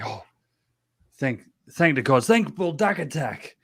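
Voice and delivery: relieved thanks. Acted. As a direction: gush thanks like a rescued council. Council greets rescuers with relief.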